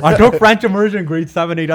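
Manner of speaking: loud, high, laughing confession. Real, not acted. Comic tells host where the French bit began, laughing. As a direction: burst out with it through laughter.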